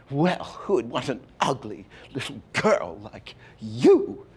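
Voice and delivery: lightly voiced hard snap at him; crisp, hard delivery. Actress scolding the little girl, hitting each word hard. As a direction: hit the words crisp and hard, slowly. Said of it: "she hit him so crisply and so hard".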